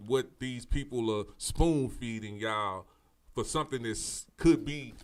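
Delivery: ordinary talk, nothing marked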